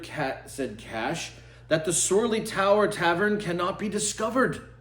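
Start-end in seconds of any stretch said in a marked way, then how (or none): 1.7-4.7 higher and louder, a triumphant discovery; proud announcement. Acted. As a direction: announce it like a proud little detective. Cash announces his discovery.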